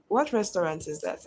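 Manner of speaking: normal talking voice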